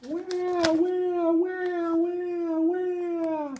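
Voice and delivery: put-on very high baby wail of wah; high wah-wah crying. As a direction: howl like an upset infant. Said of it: "The baby cried even louder".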